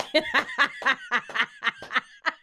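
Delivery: real loud belly laugh. Real, not acted. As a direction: laugh hard in a long run. Laughing at friend's confession.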